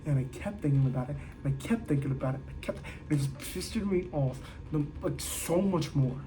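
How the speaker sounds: low, soft fast grumble; stewing over it under the breath; quiet sulky mumble; brooding mutter. Real, not acted. Fading brooding repetition.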